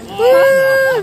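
loud, high cheer; excited cheer. Real, not acted. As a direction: let out a big woo. Cheering at the stadium.

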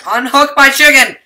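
loud angry yell. Real, not acted. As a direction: shout it at him. Yelling at friend in game.

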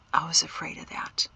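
soft unvoiced whisper. A narrator's reading. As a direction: breathe it out, barely there. Tender parting between husband, wife.

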